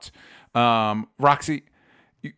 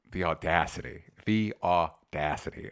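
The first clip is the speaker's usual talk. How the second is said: lower, softer, mock-scandalised; mock outrage; 6 semitones lower, 5 dB quieter than usual. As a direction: scoff at their nerve, dropping the voice. Scoffing at a Showgirls tie-in.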